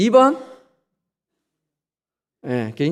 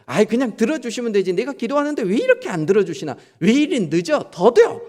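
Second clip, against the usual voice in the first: higher, whiny impatient grumble; impatient complaining; 6 semitones higher than usual. Retold or imitated. Believer grumbling that prayers go unanswered, higher whiny. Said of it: "이런 생각이 든다면".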